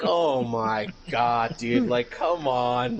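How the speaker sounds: slow, drawn-out groan of disbelief; exasperated. Retold or imitated. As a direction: roll your eyes at the cheesy line. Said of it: "I was like".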